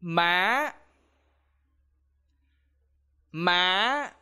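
Má with pitch sliding up high; high rising tone. Shown as a demonstration. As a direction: let Má climb upward. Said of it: "raise your voice when you speak".

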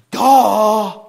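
loud put-on duh of a dummy; a dopey self-mocking duh. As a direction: slap your forehead out loud. Realizing a missed truth.

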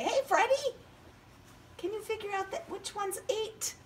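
very high cartoon puppet voice; squeaky puppet voice. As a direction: speak as a tiny puppet. Puppet character voice.

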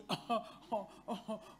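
chuckling mid-sentence; amused laugh. Real, not acted. Preacher amused at calling herself an executive, chuckling. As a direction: break into a short run of laughter. Amused at her own grand wording.

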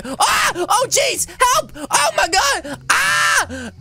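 high play-terrified shrieks; hammed-up wailing panic; panicked screaming. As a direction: scream for help as the monster grabs you. Attacked by a dinosaur in game.

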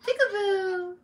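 put-on high, playful singsong to a baby; peekaboo baby talk. Mama playing peekaboo with her baby, high and playful. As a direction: play peekaboo in a high cooing voice.

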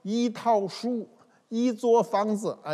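一 in 一套书 and 一座房子 held on a level high first tone, no tone change, slow; foreigner's Chinese. Retold or imitated. Teacher imitating a foreigner reading 一套书, slow level 一. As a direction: mimic a foreign learner saying 一 flat as in the dictionary. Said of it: "外国人说".

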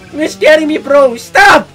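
high, loud frightened shouts; genuinely spooked, yelling at the screen; scared screaming to viewers; real scared yelling. Real, not acted. Streamer scared by game scene.

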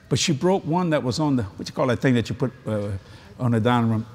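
normal talking voice

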